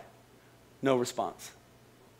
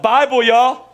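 usual speaking voice

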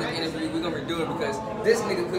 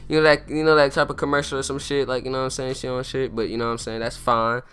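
ordinary talk, nothing marked

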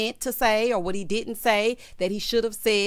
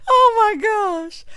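high, loud, drawn out through laughter; laughing hard; 12 semitones higher, 9 dB louder, much slower than usual. Real, not acted. Reacting to a text proposal, laughing hard. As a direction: gasp it out slowly at the tail of a cackle.

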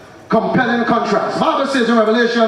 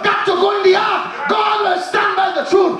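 full-force preaching; 5 semitones higher than usual. Acted. Preacher driving home God's truth to the congregation, shouting. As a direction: thunder it at the congregation.

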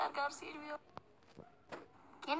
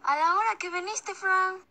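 high, loud call-out; loud mock scolding; 9 semitones higher, 11 dB louder than usual. Telling off a friend for coming late, high and loud. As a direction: shout it up high at the latecomer.

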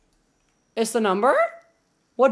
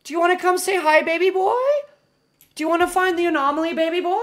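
excited dog-owner voice; 9 semitones higher, 7 dB louder, faster than usual. Real, not acted. Streamer calling the dog to play, excited baby talk. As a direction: rile up the dog, pitched way up.

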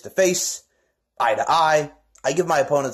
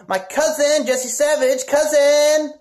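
hyped-up call; 9 semitones higher, slower than usual. Acted. Hyping up his cousin's name.

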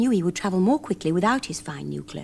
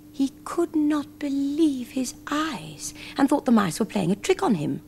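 usual speaking voice

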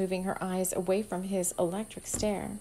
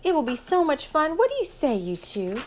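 put-on excited girl; 7 semitones higher than usual. A narrator's reading. Amber eager, high.

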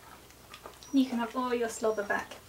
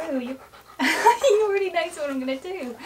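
laughing; 5 semitones higher, 8 dB louder than usual. Real, not acted. Dog interrupts the intro.

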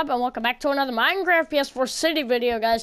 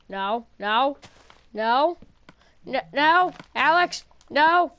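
rising panicked no; 3 semitones higher, 4 dB louder, slower than usual. Real, not acted. Pleading Alex to stop.

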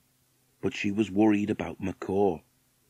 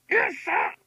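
very high macaw squawk; parrot voice. A narrator's reading. Macaw echoing the waitress through the window, squawking. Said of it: "said macaw through the open window".

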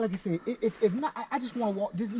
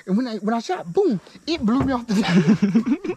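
excited laughing; 11 dB louder than usual. Real, not acted. Excited retelling of the recoil.

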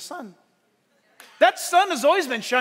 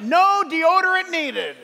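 mock product label; 5 semitones higher, 5 dB louder, slower than usual. Reading imagined tag on new body.

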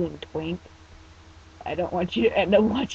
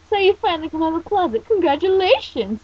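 high, loud congratulations; cheering; 10 semitones higher, 7 dB louder than usual. Acted. Shop owner greets teen coming out, cheering. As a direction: cheer it out, raised and teasing. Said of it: "Yuko cheered".

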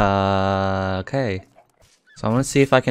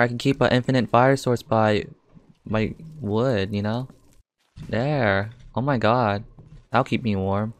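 ordinary delivery

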